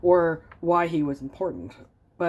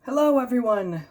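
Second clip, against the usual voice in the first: excited; 8 semitones higher, 5 dB louder than usual. Acted. Bunnies greet the garden.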